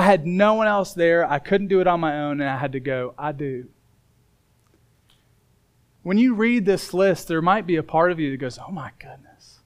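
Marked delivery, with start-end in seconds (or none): 3.2-3.7 softer, lower retold reply; humble admission. Stranded boy accepting the principal's help, humble admission. 8.6-9.6 whispered, overwhelmed inner voice; daunted whisper. Acted. Listener reading a daunting list, overwhelmed whisper.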